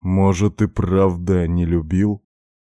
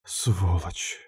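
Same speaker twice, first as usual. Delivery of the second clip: weak, softer rasped insult; exhausted wheeze; 7 dB quieter than usual. A narrator's reading. Katya curses the man pinning her, exhausted wheeze. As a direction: spent and husky, softly. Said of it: "обессиленно просипела она".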